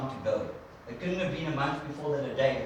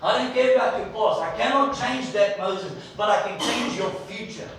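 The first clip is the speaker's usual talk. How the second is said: firm reassurance; 5 semitones higher, 6 dB louder than usual. Retold or imitated. God reassuring Moses firmly.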